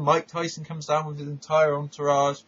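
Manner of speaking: ordinary talk, nothing marked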